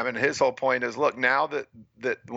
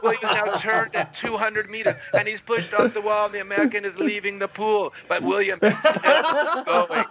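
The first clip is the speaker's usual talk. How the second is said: higher, laughing mock play-by-play; gushing announcer; 7 semitones higher than usual. Retelling how the announcer only praised William, a higher laughing mock commentator. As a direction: build the broadcaster's awe for the star, laughing. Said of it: "So, so then it's just praise".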